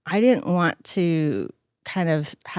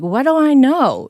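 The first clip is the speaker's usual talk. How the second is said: higher and louder, baffled daughter; bewildered protest; 8 semitones higher, 8 dB louder than usual. Retold or imitated. Daughter protesting to angry dad, baffled and loud. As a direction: throw up your hands, confused. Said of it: "And I'm like".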